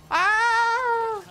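loud, high, held "ah"; loud cry. Shown as a demonstration. Showing viewers how loud Takbiran gets, loud high cry. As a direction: belt out a high wordless cry. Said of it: "like, a very loud".

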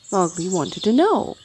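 usual speaking voice

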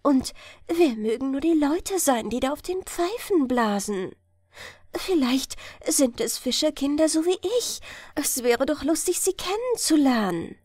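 put-on a child wondering at the magic island; girl's dreamy voice. A narrator's reading. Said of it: "dachte Hella".

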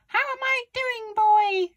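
put-on very high-pitched, loud old granny shout; shouting grandma. A narrator's reading. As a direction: holler down as a triumphant Grandma. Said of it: "she shouted".

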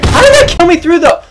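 loud, high outraged complaint; raging gamer. Real, not acted. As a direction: rage at the unfair death. Killed through cover, outraged.

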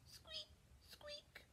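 tiny, very high, soft squeak; high-pitched whispery mouse squeaks. Acted. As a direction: squeak softly like a little mouse. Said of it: "What does that sound like".

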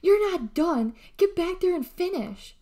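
high, loud furious order; angry yelling. A narrator's reading. Gal bangs on her sister's door, yelling angrily. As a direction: yell it as a command. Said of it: "screaming the numbers to nowhere".

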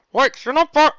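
talking through a mouthful; mouth full. Acted. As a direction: say it round a bite of meat. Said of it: "With a mouthful, he said".

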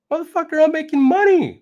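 high, loud shout; mock rapper shout. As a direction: yell it back proudly.

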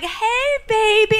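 high, slow crooning; imitating Geechee sweet-talking the cat. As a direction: coo it the way Geechee did. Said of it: "He's like".

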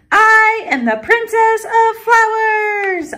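high, slow, drawn-out proclamation; grand announcement. A narrator's reading. Girl in flower crown and cape, grandly announcing. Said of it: "I announced".

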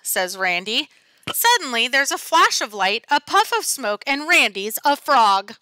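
ordinary delivery

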